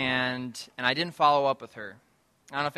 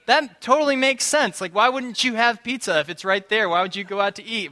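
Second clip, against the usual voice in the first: mock enthusiastic agreement; 5 semitones higher, 5 dB louder than usual. Voicing the guys agreeing loudly.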